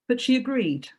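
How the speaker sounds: plain reading voice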